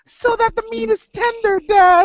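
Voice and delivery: high, sweet little-boy voice, lightly hammed up; a kid answering his father brightly, high-pitched; mock little kid. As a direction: play the proud dad's innocent son chirping his lesson. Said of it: "Cannibalism. It's like".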